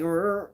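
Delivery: short rolling growl noise; playful "rrr" of a growling belly; imitated stomach growl. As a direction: mimic a rumbling stomach. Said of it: "My stomach was like".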